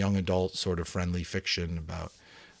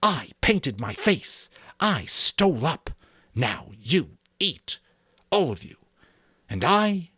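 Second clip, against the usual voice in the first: high, slow, loud fury; Jack's rage; 7 semitones higher, 4 dB louder than usual. A narrator's reading. Jack demanding the boys eat, slow high fury. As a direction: spit each phrase out in rage. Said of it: "his rage elemental".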